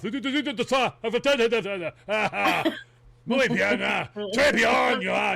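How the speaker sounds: imitating an angry French driver muttering; angry French driver put-on; mock French grumbling. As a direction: grumble like a Frenchman cut off in traffic. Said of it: "he starts the whole French thing when he gets angry".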